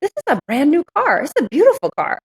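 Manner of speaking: high-pitched, sassy self-talk; exasperated, hammed-up disbelief; incredulous scolding. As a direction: give yourself a playful telling-off, pitch leaping up. Acted scolding of herself in hot car.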